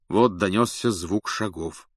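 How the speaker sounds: ordinary delivery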